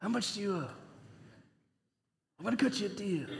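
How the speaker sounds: hushed mobster impression; mafia voice. Preacher imagines steward collecting debts like mafia, hushed mobster voice. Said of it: "I've never really watched those mafia movies".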